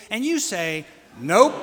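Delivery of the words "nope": loud, punchy congregation response; the answer in a call and response; shouted nope. Shown as a demonstration. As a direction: bark it back as one word. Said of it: "use it as a call and response".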